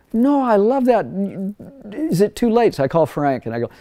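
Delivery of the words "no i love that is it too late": dismayed protest. Retold or imitated. Retold dismay about fixed compressor.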